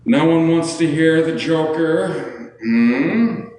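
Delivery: high, slow villain voice; Joker. Acted. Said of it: "You wanna hear the Joker voice?".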